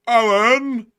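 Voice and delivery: high loud holler; calling out. Pretend. Host summons his marmot puppet Alan, calling out. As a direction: call Alan over from another room.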